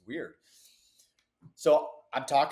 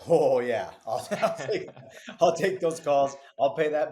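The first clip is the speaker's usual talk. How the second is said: laughing; 3 semitones higher, 7 dB louder than usual. Real, not acted. Laughs about the worth of calls.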